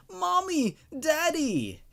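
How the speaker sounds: high, scared cry for parents; frightened children crying out. Acted. Twin daughters crying for their parents at night, high and frightened. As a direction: call out like a terrified little kid. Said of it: "we heard them both cry".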